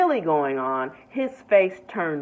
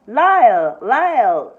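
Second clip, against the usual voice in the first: loud call across the store; 2 semitones higher, 8 dB louder, slower than usual. A narrator's reading. Familiar voice calling Lyle across the crowded store, loud call. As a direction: call his name out loud. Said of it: "the voice called out".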